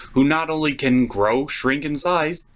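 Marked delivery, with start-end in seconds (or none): none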